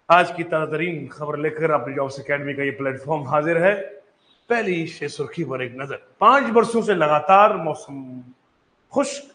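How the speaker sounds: lightly voiced news anchor opening; mock news read. Shown as a demonstration. Teacher playing a newsreader for the students. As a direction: read it like a TV headline intro. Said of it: "mock news bulletin intro".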